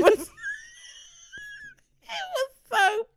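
high, helpless laughing speech; real cracking-up, pitch shooting up; laughing hard. Real, not acted. Laughing over her blisters.

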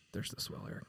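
quick unvoiced whisper to the crew; whispered aside. Real, not acted. Director whispering a cue to the sound guy. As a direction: whisper it off to the side.

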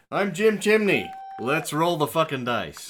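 hammed-up host persona shouting; game show shout. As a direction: roll the dice like a TV host.

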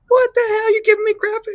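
high, loud disbelieving yelp; mock outrage. Lieutenant reacting to smoking wing commander, high outraged yelp. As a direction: squeal it in outrage. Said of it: "I'm like".

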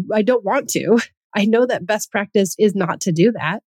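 normal talking voice

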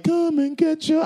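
high, slow cocky taunt; mock Star-Lord taunt. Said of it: "he's like".

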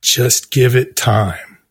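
a hint of a calm friend on the phone; calm reassuring publicist. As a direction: talk him down gently, slowly.

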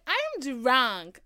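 put-on loud, drawn-out drunken announcement; drunk self. Happy drunk telling everyone she is drunk, loud drunken drawl. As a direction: imitate yourself drunk, slow, high and loud. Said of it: "That's how you know I'm drunk".

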